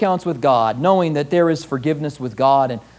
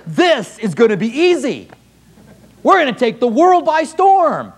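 higher, louder, giddy and sure; overconfident excitement; 7 semitones higher, 4 dB louder than usual. Retold or imitated. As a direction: brag like it's all too easy. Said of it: "I imagine those hundred twenty said".

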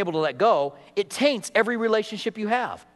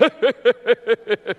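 louder imitated laughing; mock guffaw; 3 semitones higher, 5 dB louder than usual. Golfers laughing at the rude joke, a louder mocking laugh. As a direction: ham up the buddies' laugh at the joke. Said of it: "and they're both like".

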